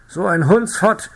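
slow, high, loud curse; furious shout. A narrator's reading. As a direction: spit the insult out in rage. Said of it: "schrie der Reiter". (wütend geschrien)

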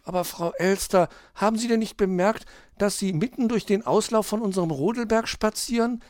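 put-on high, earnest child voice; little hedgehog. Hedgehog points out magpie's mistake, high child voice. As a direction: politely protest in a small high voice. Child politely objects to scolding.

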